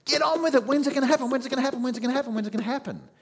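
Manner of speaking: high, loud, impatient repeated plea; impatient creation. Acted. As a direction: urge it on, pleading again and again. Creation impatient, voiced eagerly.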